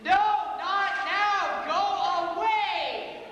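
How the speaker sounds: host shouts at someone at the door to go away; calling out to shoo. Acted. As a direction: shout them off, drawn out. Shooing away an intruder.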